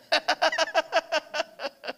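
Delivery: high falling laugh; high amused laughter. Real, not acted. Speaker laughs at daughter's remark.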